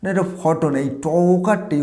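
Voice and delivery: ordinary talk, nothing marked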